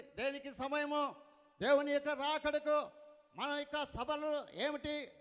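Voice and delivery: loud, high, driving sermon delivery; preaching. Real, not acted. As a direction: proclaim it forcefully to the congregation. Preaches at full force.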